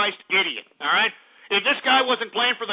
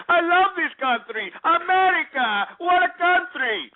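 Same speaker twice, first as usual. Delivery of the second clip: high, slow mock Russian immigrant; mocking a Russian caller in a hammed-up accent; 9 semitones higher, slower than usual. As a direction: parody a Yakov Smirnoff line, slowed and raised. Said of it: "Yakov Smirnoff schmucks".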